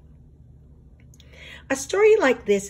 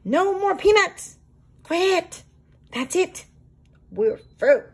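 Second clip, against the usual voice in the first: defiant critters; 9 semitones higher than usual. Acted. Animals chant their strike demands.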